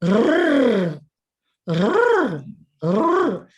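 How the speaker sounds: a long trilled "Rrrr"; rolled R. Shown as a demonstration. Teacher showing the class a rolled R, long trill. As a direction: roll the R on the tongue tip. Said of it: "Anybody can roll your R's like".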